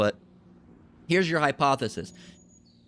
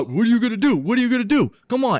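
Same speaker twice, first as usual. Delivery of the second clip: loud, high-pitched repeated taunt; goading shout; 10 semitones higher, 7 dB louder, faster than usual. Acted. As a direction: shout like someone daring a man to hit him. Aggressor goading in acted fight.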